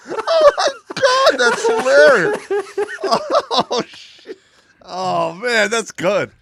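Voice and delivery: loud, high, breathless laughter through the words; can't stop laughing; real laughing fit; laughing hard. Real, not acted. Laughing at the joke.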